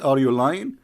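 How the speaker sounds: ordinary talk, nothing marked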